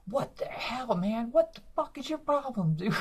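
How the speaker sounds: high, exploding what the hell; shrieking disbelief, losing it; retold freakout. Comic freaking out at a heckler, high and furious. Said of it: "break character".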